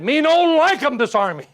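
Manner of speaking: high, loud broken English; a lightly hammed-up chief; Indian chief. Chief complaining to the officer, high and loud. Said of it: "He said".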